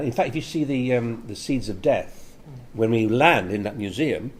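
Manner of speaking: ordinary talk, nothing marked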